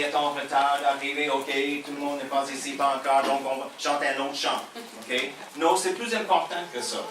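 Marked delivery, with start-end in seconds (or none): none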